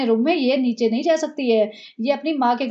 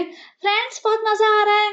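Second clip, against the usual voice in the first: much higher, eager put-on squeal of a pampered wife; mock giddy wife; 9 semitones higher than usual. Mocking the wife eagerly telling Sunny she needs no getting ready. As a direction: gush it out, ready to run.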